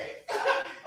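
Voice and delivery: a quick airy laugh up high; high breathy giggle. Real, not acted. Laughing at the threat on live. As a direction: giggle at your own boast.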